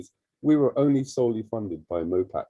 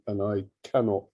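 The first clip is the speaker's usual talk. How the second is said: ordinary talk, nothing marked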